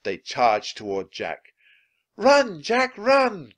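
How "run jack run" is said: high, loud frantic cry; urgent high-pitched yell. A narrator's reading. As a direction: cry out to save a friend. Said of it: "cried Annie".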